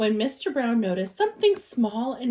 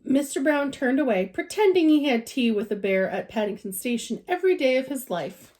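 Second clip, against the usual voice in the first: ordinary delivery, nothing marked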